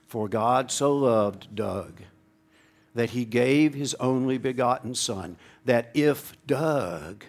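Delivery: slow, deliberate, own name inserted; slow personal recitation. Shown as a demonstration. Preacher reciting John 3:16 with his own name, slowly. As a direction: recite it as if it's about you. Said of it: "let's try it again".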